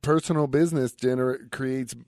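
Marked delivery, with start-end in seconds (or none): none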